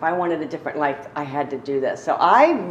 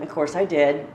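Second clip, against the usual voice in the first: ordinary talk, nothing marked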